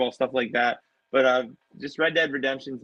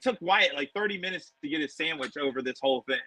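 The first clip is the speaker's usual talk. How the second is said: ordinary talk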